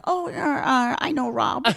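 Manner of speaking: halting celebrity impression; Crystal. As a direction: imitate her with stretched, hesitant sounds. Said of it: "like, Crystal, like, you're like".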